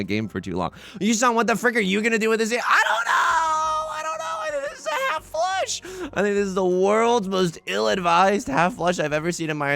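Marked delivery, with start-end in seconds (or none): 1.0-2.5 mock heckler. 2.5-9.7 high, squeaky, half-shouted panic. Pretend. Squeaky panicked voice over bad hand.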